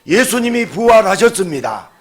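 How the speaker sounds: loud, high proclamation with 예수님이 punched; preaching proclamation. Acted. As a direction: ring out 예수님이 like a declaration. (예수님이를 힘주어 외치는 선포)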